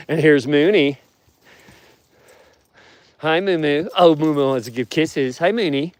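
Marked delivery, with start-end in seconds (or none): 3.2-5.9 higher, sweet pet-talk greeting. Real, not acted. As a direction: coo at the wolf like a puppy. Greeting wolf Mooney at fence.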